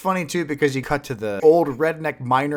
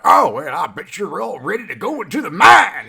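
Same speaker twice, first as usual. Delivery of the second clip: louder, higher folksy miner voice; ride miner guy; 8 semitones higher, 7 dB louder than usual. Retold or imitated. Ride host welcoming riders into the mine, loud folksy voice. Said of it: "He's just like".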